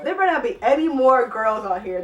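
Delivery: loud, annoyed warning; exasperated warning. Real, not acted. Vlogger fed up with bad sex writing, loud warning. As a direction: declare it loudly, fed up with the excerpts. Annoyed reader warns about next excerpt.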